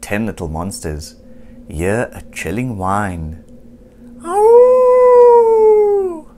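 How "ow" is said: very high, loud howling Ow; werewolf howl. A narrator's reading. Werewolf howling in the castle, long high howl. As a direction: howl it like a wolf at the moon. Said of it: "howls a werewolf".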